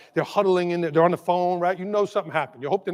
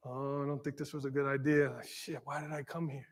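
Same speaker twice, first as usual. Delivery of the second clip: low, hushed muttering to himself; retold nervous aside; 6 semitones lower, 14 dB quieter than usual. Teacher facing hostile moms, retold muttered second thoughts. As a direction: drop much lower and softer, regretting it.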